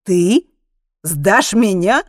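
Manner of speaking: loud, outraged retort building in pitch; indignant yell; incredulous anger. A narrator's reading. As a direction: start in disbelief, burst into outrage. Incredulous driver retorts angrily.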